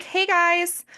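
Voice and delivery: mock the sing-song hey guys of an influencer; playful imitation of a vlogger opener; Kim Kardashian impression. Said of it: "that's a voice, that's a tone of voice".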